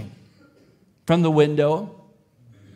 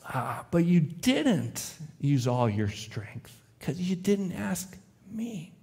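softer, slow and tender, like a father lifting his son's chin; gentle father; 7 dB quieter, slower than usual. Acted. Father kneels and answers his son, soft and tender. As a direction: let it land gently, almost hushed. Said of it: "kneels gently".

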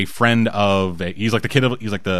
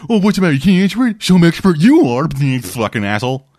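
loud, higher mocking taunt; condescending; 5 semitones higher, 4 dB louder than usual. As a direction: imitate someone talking down to an expert. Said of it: "like, really condescending".

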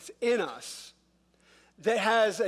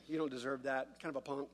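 low, much softer resentful aside; under the breath; 5 semitones lower, 10 dB quieter than usual. Acted. Grumbler privately resenting someone's good fortune, muttered under the breath. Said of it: "but under your breath, you're grumbling".

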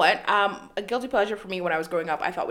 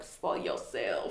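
mumbled aside; 11 semitones lower, 9 dB quieter, much slower than usual. Retold or imitated. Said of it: "I was like".

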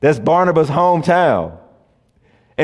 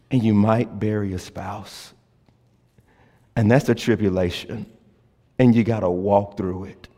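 tender preaching; 8 semitones lower, 8 dB quieter than usual. Acted. Pastor naming the congregation's hardships, soft and tender. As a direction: speak each hardship gently, with long pauses.

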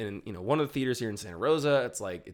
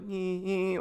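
imitated funny laugh; 6 semitones higher than usual. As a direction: mimic an odd little laugh, pitched up. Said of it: "like, a funny laugh".

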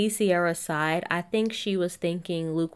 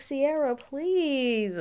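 exasperated plea; 8 semitones higher, much slower than usual. Pretend.

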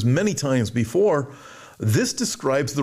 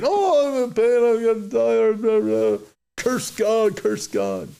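mock agony; 9 semitones higher, slower than usual. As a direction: overplay a groaning, dying complainer. Mock dying sinner.

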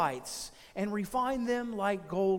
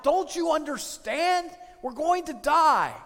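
high, loud and slow desperate shout; frantic shout; 6 semitones higher, 6 dB louder than usual. Retold or imitated. Panicked castaway shouting at his calm friend. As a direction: shout it at him, desperate to be heard. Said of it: "He began to shout".

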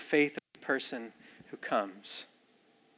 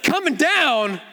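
mock surprise; 10 semitones higher, 4 dB louder, much slower than usual. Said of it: "It's like".

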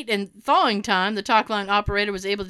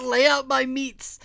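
French chef; 2 semitones higher than usual. Retold or imitated. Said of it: "after I yelled out".

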